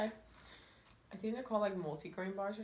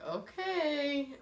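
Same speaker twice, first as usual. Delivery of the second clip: high, drawn-out sceptical okay; doubtful okay; 8 semitones higher, 4 dB louder than usual. Retold or imitated. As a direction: imitate an unconvinced mother, pitched up. Mom's doubtful reply, acted.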